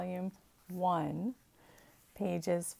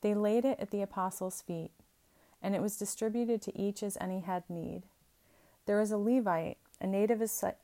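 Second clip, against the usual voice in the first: scripture reading; 2 semitones higher, 4 dB louder, faster than usual. Acted.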